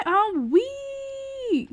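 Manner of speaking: high-pitched drawn-out "wee"; giddy, sky-high whoop; excited squeal. Pretend. Hyped greeting as story time starts.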